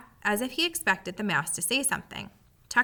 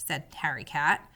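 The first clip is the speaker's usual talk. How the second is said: ordinary delivery, nothing marked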